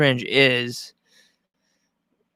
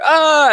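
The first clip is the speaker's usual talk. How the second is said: piercing, high, louder fake scream; high mock scream; 7 dB louder than usual. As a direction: scream like a victim being attacked. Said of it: "and then you hear".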